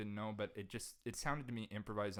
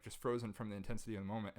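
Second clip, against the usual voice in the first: ordinary talk, nothing marked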